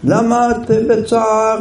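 high, slow worried neighbour voice; concerned neighbour. Retold or imitated. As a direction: ask gently and slowly what's wrong. Neighbor comforts crying woman.

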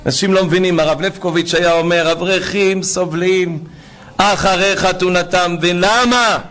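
high, drawn-out preaching cry; fervent preaching. Real, not acted. Preacher urging the value of the repair, fervent preaching. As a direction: preach with full force, pitch held high.

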